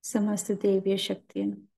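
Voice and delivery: calm, unhurried meditation guide with long pauses; guided meditation. Acted. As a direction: lead the masters gently into stillness and prayer.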